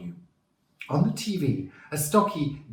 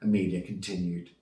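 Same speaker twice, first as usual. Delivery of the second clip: plain reading voice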